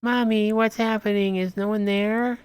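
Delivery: put-on high little kid voice; small child asking mommy what's happening; child's voice. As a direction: play a scared small child asking mom.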